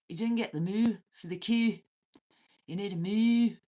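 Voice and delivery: loud, high playful teasing moo; mock moo call. Streamer teasing Lex about his cow noise, loud mock moo. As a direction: belt out a silly moo to tease.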